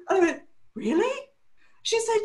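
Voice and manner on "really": high, disbelieving little question; incredulous. Retold or imitated. Stylist to happy crying client, high incredulous question. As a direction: ask it in squeaky mock surprise.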